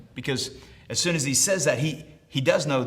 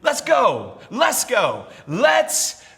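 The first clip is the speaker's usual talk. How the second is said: raised, impassioned sermon voice; full-force preaching; 9 semitones higher, 5 dB louder than usual. Acted. Preacher voicing a man's faith and doubt, full-force preaching. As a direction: preach it out with rising urgency.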